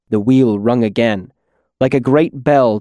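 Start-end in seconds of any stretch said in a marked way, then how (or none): none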